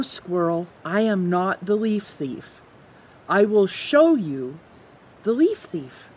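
plain reading voice

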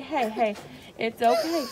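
loud firm hey hey over her crying. Real, not acted. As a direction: cut through the panic with your voice. Mother calling over the child's panic.

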